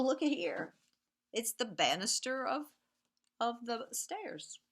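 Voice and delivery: lively storybook reading with whispered refrains; reading to children. A narrator's reading. Teacher reads Two Little Trains to the class, sing-song storytelling. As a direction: read it like a bedtime picture book, rhythmic and playful.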